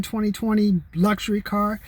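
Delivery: ordinary talk, nothing marked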